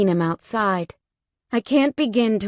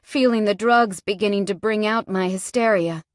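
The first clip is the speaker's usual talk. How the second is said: ordinary talk, nothing marked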